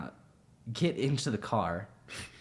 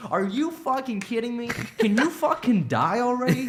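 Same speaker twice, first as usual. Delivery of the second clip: mock exasperated shout; 13 semitones higher, 6 dB louder than usual. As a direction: drag it out, fed up with her. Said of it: "he's like".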